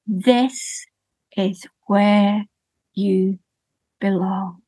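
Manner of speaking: put-on old woman lovingly keeping her cow; tender old woman. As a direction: welcome the cow home lovingly. Old woman welcomes cow home.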